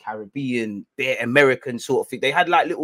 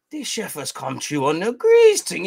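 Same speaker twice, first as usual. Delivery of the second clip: usual speaking voice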